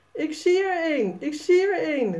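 high, excited calling out; thrilled, calling her friend over; enthusiastic call. A narrator's reading. Sanne spots a spider and calls Jasper, excited. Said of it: "roept Sanne enthousiast".

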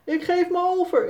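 hammed-up giving up; mother playfully surrendering, calling out; called out. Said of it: "roept Sannes moeder".

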